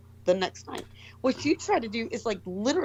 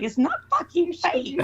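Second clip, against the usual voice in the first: slow, higher-pitched, emphatic denial; indignant retort; 8 semitones higher, 4 dB louder, slower than usual. Retold or imitated. Host retells defending a friend's accent, indignant. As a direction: insist it's real, drawing each word out. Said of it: "I was like".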